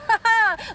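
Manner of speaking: short high cackle; mocking laugh. Real, not acted. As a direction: toss in a quick high cackle.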